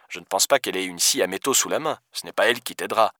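soft, muted phone voice; reasoning police deputy. Acted. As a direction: object quietly down the line.

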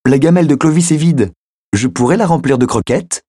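put-on little girl asking to feed the dog; eager little girl. A narrator's reading. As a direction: plead sweetly like a small child. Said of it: "La petite fille".

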